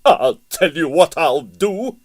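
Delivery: mounting matronly threat; rising threat. A narrator's reading. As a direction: build the threat the second time. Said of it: "said the tornado".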